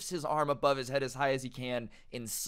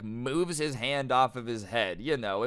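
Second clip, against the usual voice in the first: usual speaking voice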